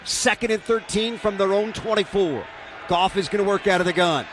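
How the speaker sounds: radio play-by-play announcer. Real, not acted. Live play-by-play call.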